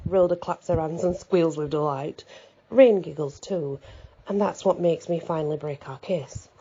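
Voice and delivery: usual speaking voice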